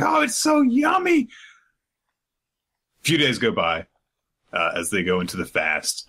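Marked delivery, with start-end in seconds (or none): 0.0-1.3 very high shrieky delighted squeal; greedy child. Retold or imitated. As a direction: squeal it way up, gleeful. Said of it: "she's like".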